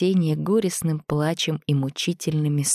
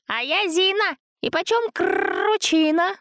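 piglet voice; slower than usual. A narrator's reading. Zina's piglet voice.

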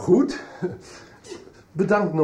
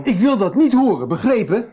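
louder and higher, furious and final; angry telling-off; 6 semitones higher, 6 dB louder than usual. Acted. Father forbids Joris to talk about his brother, angry raised voice. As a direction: lay down the law, no argument. Father scolds son over broer.

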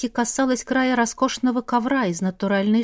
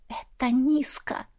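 soft, slow whisper; 3 semitones higher, 5 dB quieter than usual. A narrator's reading. Daughter looking into father's eyes, whispered reproach. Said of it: "прошептала".

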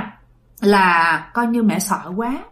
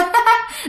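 high teasing ghostly laugh; mocking ghost laugh; 12 semitones higher than usual. Ghost mocking the scared mother, high teasing cackle. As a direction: imitate the ghost laughing to mock her. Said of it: "bà cười vậy đó".

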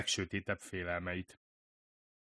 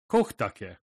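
louder call, falling at the end; exclaimed name; 7 dB louder than usual. A narrator's reading. Woman in the doorway greeted by name, called out.

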